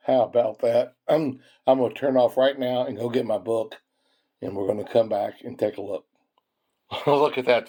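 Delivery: usual speaking voice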